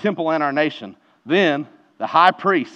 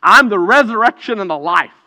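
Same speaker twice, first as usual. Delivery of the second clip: raised, higher, louder declaration of "I'm the resurrection and the life"; louder emphatic proclamation; 6 semitones higher, 9 dB louder than usual. Retold or imitated. Preacher repeating Jesus' claim to the congregation, louder. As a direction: preach "I'm" out strong and high. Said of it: "think about who this is".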